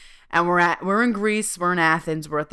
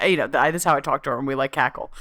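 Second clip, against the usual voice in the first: ordinary talk, nothing marked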